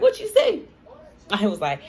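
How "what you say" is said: high, loud retold "huh?" reaction; incredulous high-pitched imitation of your own reaction; mock disbelief. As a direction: squeal it as if you misheard, half laughing. Retold startled reaction to his accent.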